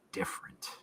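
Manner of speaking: hushed, breathy reveal; a storyteller's spooky whisper. A narrator's reading. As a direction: drop to a whisper on the eerie word. GM hushes eerie realization.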